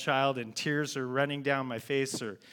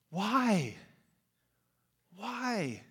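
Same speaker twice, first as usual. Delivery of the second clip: high pleading why that fades away; anguished why; 5 semitones higher than usual. Acted. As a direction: ask heaven, then let it fall. Voicing people's anguished question.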